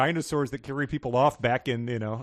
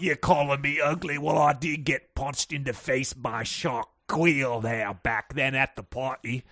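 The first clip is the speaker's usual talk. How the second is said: lightly put-on British voice, slow; a touch of refined British pique; mock British accent. Mock British bickering role-play.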